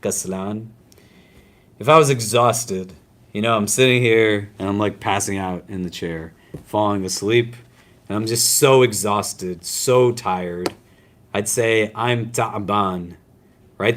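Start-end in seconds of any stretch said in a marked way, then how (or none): 0.0-0.7 kaslan said slowly, syllable by syllable; Arabic word kaslan. Shown as a demonstration. Teacher sounding out an Arabic word for students, slow and clear. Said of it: "Do you hear how it has, like, a similar sound". 11.3-13.2 ta'ban said slow with a catch in the middle; slow down and land on ta'ban; Arabic word ta'ban. Shown as a demonstration. Teacher giving students the Arabic for tired, slow. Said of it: "The tiredness is me".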